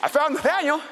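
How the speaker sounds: laughing, high indignant what about me; half-laughing complaint; laughing protest. Retold or imitated. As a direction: play Philip feeling overlooked. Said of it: "I'm like".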